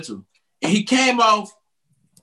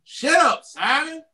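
quoting the album in a higher voice; 9 semitones higher, slower than usual. Retold or imitated. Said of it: "like on that Goodie Mob album".

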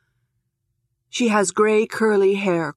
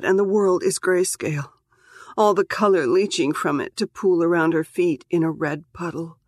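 plain reading voice

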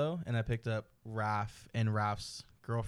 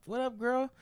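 much higher, sing-song greeting back; sassy girl voice; 13 semitones higher than usual. Retold or imitated. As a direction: answer back in the same bubbly high voice. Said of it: "I didn't mean to talk in that voice".

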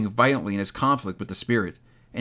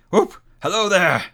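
higher, loud halloo; joyful whoop; 7 semitones higher, 6 dB louder than usual. A narrator's reading. Scrooge happy as a baby, joyful whoop. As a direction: whoop and call out gleefully.

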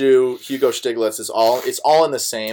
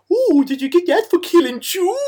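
very high, hammed-up goofy singsong impression; Adam Sandler. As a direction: squeal it in a silly comedian voice, stretching the last word.

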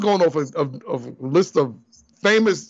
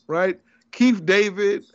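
normal talking voice

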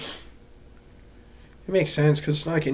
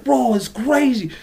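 higher, slow, easygoing bro voice; lightly hammed-up guy from the DMV; mock homie voice; 8 semitones higher, slower than usual.

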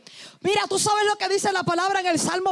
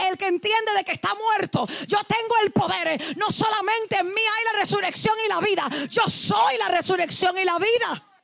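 raised, ringing preacher voice; preaching at full force; 4 semitones higher than usual. Real, not acted. Preacher to congregation, proclaiming with full force.

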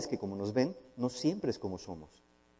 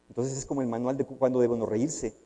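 usual speaking voice

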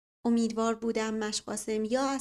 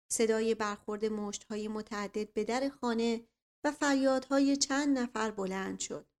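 plain reading voice

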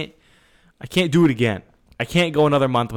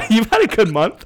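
laughing; 9 semitones higher, 4 dB louder than usual. Real, not acted. Teasing co-host, laughing.

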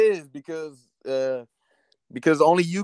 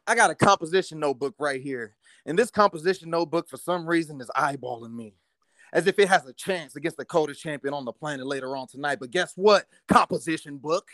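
wrestling promo; 6 semitones lower, 3 dB quieter than usual. Acted. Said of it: "could you cut us a promo".

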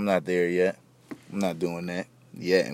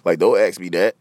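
exasperated, louder, rising at the moocher; fed up, push back hard; annoyed; 4 dB louder than usual. Real, not acted. Fed up with friends asking for a lighter, annoyed.

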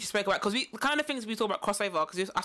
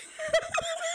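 high shrieking laugh. Real, not acted. Laughing at the story.

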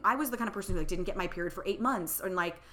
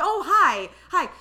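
startled greeting; 10 semitones higher, 10 dB louder, slower than usual. Retold or imitated. Realizing the caller's name.

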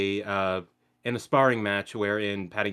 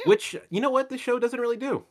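much higher, louder exclamation; excited aside about the show; 14 semitones higher, 5 dB louder than usual. Real, not acted. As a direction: burst out with it.

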